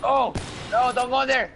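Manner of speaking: high, loud panicked callout; urgent yell. Real, not acted. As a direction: shout a warning at a teammate. Panicked callout during fight.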